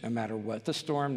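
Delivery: ordinary talk, nothing marked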